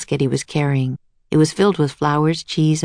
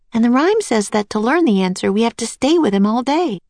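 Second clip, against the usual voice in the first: lightly voiced girl, raised pitch; a higher child's voice reasoning with her brother; Annie, insistent; 6 semitones higher than usual. A narrator's reading.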